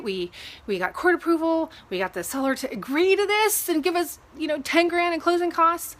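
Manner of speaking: mildly heated retelling of a complaint; retold frustration. Retold argument with the lender.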